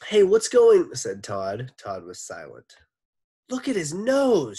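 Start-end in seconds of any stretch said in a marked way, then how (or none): 0.1-0.9 put-on high, loud boy calling out. Todd calls out loudly. 3.5-4.6 high, loud startled yell; shouting. Acted. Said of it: "shouted Eric Bacon".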